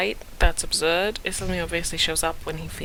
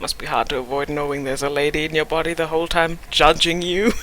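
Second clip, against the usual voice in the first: ordinary talk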